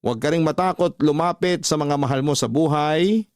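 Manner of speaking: ordinary talk, nothing marked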